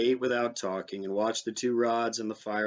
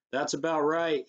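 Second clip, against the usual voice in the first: lightly raised, calling across the water; called out; 6 semitones higher, 3 dB louder than usual. A narrator's reading. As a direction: call it out to the boat, pitch a little higher. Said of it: "he called".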